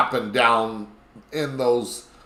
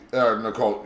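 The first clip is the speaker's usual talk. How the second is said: ordinary talk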